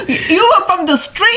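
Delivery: high, laughing tease; laughing along about the rescued cat. Real, not acted. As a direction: giggle out the line.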